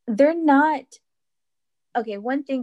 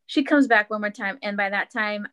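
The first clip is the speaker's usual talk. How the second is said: ordinary talk, nothing marked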